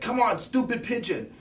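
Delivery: high-pitched, excited imitation of a teacher. Excited teacher rallying a helper to chase a pigeon, imitated excited cry. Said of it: "he gets all excited".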